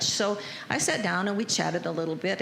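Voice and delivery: ordinary talk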